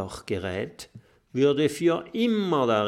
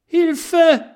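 very high, loud desperate cry for help; desperate scream; 13 dB louder than usual. A narrator's reading. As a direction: scream as you tumble downhill. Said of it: "schreien sie verzweifelt".

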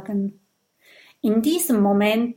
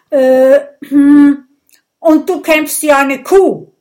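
loud, high sing-song taunt; shouted taunt; 5 semitones higher, 12 dB louder than usual. Acted. A duelist taunting the opponent, loud sing-song. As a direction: sing out the insult mockingly.

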